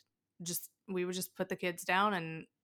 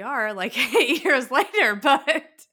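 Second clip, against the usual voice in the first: laughing; 7 semitones higher, 12 dB louder, slower than usual. Real, not acted. Laughing about surviving years later.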